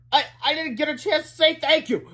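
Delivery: high-pitched woman's voice; crying out. A narrator's reading. Rescued woman stops the superhero, crying out high. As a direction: plead in a high character voice. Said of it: "cried Miss Anthorp".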